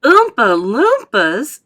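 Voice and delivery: slow, loud incredulous echo; loud, drawn-out questioning chorus; group astonishment. A narrator's reading. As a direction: stretch each word in amazement. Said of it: "everyone said at once".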